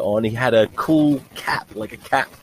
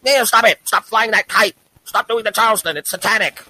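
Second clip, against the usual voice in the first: hammed-up high prude; scolding old parent; 11 semitones higher than usual. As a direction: play the horrified old lady telling Neil off. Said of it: "They'd be like".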